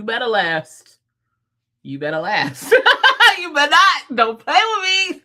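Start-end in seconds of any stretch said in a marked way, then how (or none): 2.8-5.2 high, loud, laughing warning; squealed playful scolding; laughing mock threat. Real, not acted. Mock-threatens her computer, laughing.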